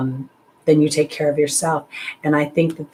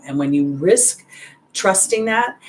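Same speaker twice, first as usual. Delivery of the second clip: ordinary delivery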